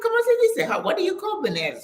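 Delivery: high puzzled question; puzzled asking. Retold or imitated. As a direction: ask high, confused about the word. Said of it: "I'm like".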